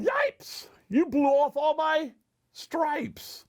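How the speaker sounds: high-pitched startled yelp, then protest; yelling zebra. A narrator's reading. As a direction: cry out as an alarmed zebra. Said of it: "The zebra yelled".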